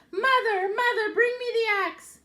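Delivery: put-on high, loud urgent boy's cry; Jack calling out. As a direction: yell for mother in panic. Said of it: "Jack called out".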